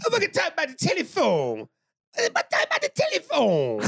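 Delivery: loud, very high hollered movie quote; drunk movie character impression. Host quoting a drunk movie character, loud high impression. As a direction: holler it high, letting the last word fall.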